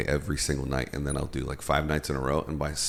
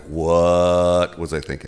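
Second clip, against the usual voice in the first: mock regret; 4 dB louder, much slower than usual. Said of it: "you're like".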